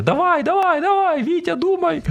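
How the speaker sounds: frantic, very high-pitched mock shouting; yelling. Passenger standing over a troubled pilot, shouting at him. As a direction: yell urgently, voice jumping way up. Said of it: "над головою стояти і кричати".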